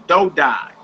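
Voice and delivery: "do die" ending in an "eye" sound; long I ending. Shown as a demonstration. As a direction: let "die" carry a long I. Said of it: "make an I sound".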